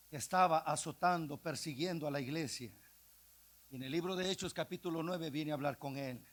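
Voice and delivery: ordinary delivery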